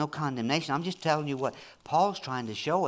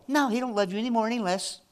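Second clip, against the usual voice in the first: normal talking voice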